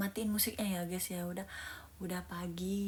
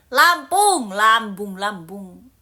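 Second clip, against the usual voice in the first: emphatic shout; 15 dB louder, much slower than usual. Real, not acted. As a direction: shout the hometown out proudly. Insists loudly on her hometown.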